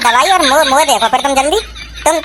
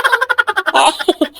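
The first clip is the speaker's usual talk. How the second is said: short laugh, higher pitch; mocking laugh; 5 semitones higher than usual. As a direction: laugh off the doctor's threat. Patient laughs at doctor's threat.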